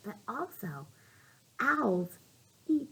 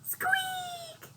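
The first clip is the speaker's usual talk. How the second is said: high mouse squeak; 8 dB quieter than usual. Acted.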